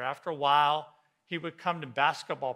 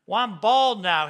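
much higher and louder, playful; joking exclamation; 8 semitones higher, 15 dB louder than usual. Retold or imitated. Willie joking about his lost hair, loud and playful. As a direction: blurt the joke out big.

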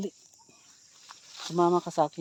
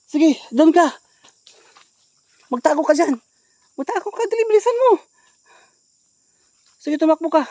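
high frantic yells to hide; panicked shouting; 12 semitones higher, 11 dB louder than usual. Acted. Urging her to run and hide.

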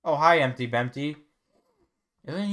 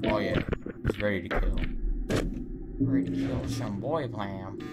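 beaver voice; 4 semitones lower, 4 dB quieter than usual. Pretend. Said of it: "That's my beaver voice".

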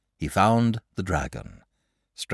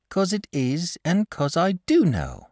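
plain reading voice

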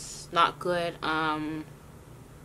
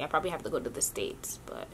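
whisper aside; 4 semitones lower, 10 dB quieter, faster than usual. Real, not acted. Unvoiced aside.

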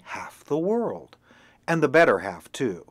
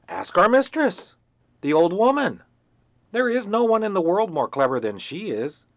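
bossy hen; 5 dB louder than usual. Acted. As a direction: lecture like a know-it-all. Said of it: "hen lectures duckling".

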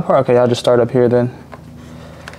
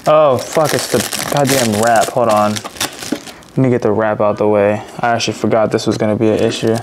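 ordinary talk, nothing marked

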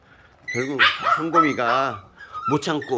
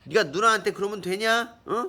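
scolding; 9 semitones higher than usual. Real, not acted. Scolding the barking dog.

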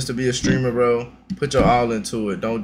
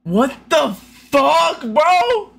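stunned disbelief; 13 semitones higher, 4 dB louder, much slower than usual. Real, not acted. Stunned by contestant's reveal.

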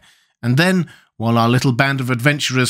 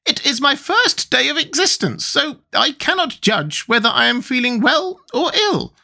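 very high squeaky creature; high-pitched squeaky character; Gump's squeaky voice. A narrator's reading. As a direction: squeak it out, far above your own voice. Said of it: "in a pensive manner".

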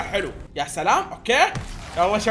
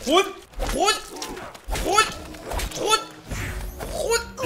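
high, drawn-out urgent calls; excited calling; 7 semitones higher, much slower than usual. Real, not acted. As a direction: hurry them to take it. Urging teammate to grab it. (نداء متحمس بصوت عالٍ)

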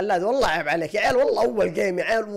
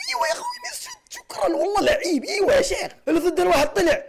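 shrieking celebration, much higher and breaking into a laugh; real, over-the-top goal celebration; ecstatic cheer; 11 semitones higher than usual. Real, not acted. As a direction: scream for joy at the goal. Teammate scores, celebration.

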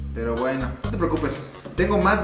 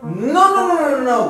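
loud protest; 8 semitones higher, 6 dB louder than usual. Acted. Friend rejects dentist idea.

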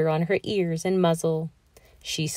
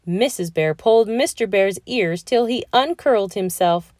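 ordinary delivery, nothing marked

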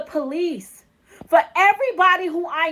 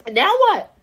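louder challenge; 4 semitones higher, 4 dB louder than usual. Real, not acted. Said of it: "repeated louder take".

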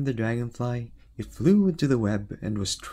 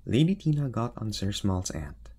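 ordinary delivery, nothing marked

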